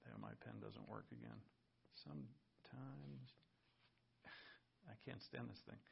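very soft, sighing complaint to oneself; real grumbling aside. Real, not acted. Preacher fighting a dead pen, muttered annoyance. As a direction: drop right down and grumble under the breath.

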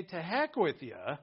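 loud, drawn-out brush-off; mock dismissal. Someone refusing to make peace, loud dismissal. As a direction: throw it out loud and slow.

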